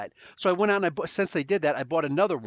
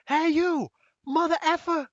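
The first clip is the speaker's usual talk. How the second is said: retold screaming; 12 semitones higher than usual. Stranger yelling at the narrator mid-crossing, high imitated screaming. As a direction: holler it across the street like a madman. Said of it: "screaming at, at, like, nobody".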